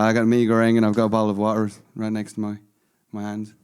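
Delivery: easy conversational Irish accent. Shown as a demonstration. Podcaster showing off his most natural accent, Irish accent. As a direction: chat casually in an Irish accent. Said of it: "I do a lot of Irish".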